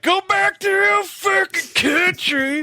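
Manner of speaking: high-pitched drawn-out mock yell; slow, strained high mock shout; Rem impression. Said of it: "it's just Rem like".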